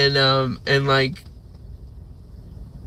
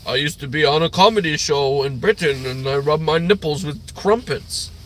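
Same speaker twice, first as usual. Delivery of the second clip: British accent impression. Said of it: "he's actually like".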